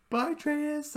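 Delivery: very high sing-song bye; high goodbye. Retold or imitated. Vlogger imitating the friends waving goodbye, very high. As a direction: chirp it up high.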